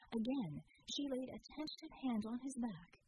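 usual speaking voice

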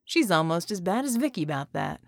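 usual speaking voice

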